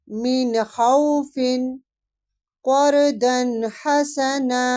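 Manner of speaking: tajweed recitation. Shown as a demonstration. Teacher recites izhar examples aloud for the class, slow chant. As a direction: chant each example slowly and clearly. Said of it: "نون کی آواز کو ظاہر کیسے کریں؟".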